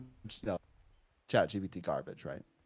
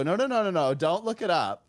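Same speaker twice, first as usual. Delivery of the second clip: higher, quick urgent stop; urgent protest; 6 semitones higher, 3 dB louder than usual. Retold or imitated. Teacher stopping a student from peeking, urgent. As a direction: cut them off with repeated, higher-pitched objections.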